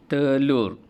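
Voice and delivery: "Telur" loud, falling; review repetition. Shown as a demonstration. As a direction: sebut "Telur" dengan kuat. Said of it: "Cikgu ulang balik".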